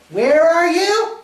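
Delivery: long, drawn-out high call; loud, high and stretched out. Acted. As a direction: holler slowly across the house. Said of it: "you call out".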